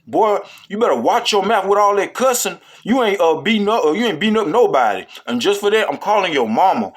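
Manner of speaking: teacher telling off a cussing student; scolding teacher. Retold or imitated. Scolding teacher voiced in story.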